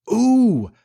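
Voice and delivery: long, high-pitched swooning "ooh"; high delighted "ooh". Acted. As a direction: coo with longing, way up high. Wendy tempted by Peter's flattery.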